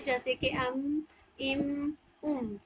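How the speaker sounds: अम इम उम, lips closing on the म sound; meem demo. Shown as a demonstration. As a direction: hum the म in अम इम उम. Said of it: "मीम की अदायगी करते हैं". (मीम की अदायगी: अम इम उम)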